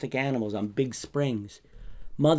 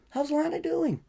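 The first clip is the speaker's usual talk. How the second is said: concerned. Acted. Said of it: "I was concerned".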